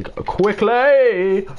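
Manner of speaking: loud and high, slowed down; sudden shout. Real, not acted. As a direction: blurt it out loud without meaning to. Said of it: "really loudly shouted there".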